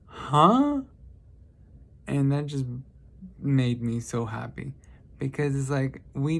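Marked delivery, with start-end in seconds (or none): 0.1-0.8 sudden high, loud confused huh; baffled huh. Retold or imitated. Kid's odd reply leaves him baffled, loud high huh. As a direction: caught completely off guard.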